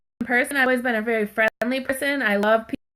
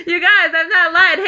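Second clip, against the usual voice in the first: high, loud words through laughter; laughing; 7 semitones higher, 6 dB louder than usual. Real, not acted. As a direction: burst out laughing while insisting. Joking about her man, cracks up.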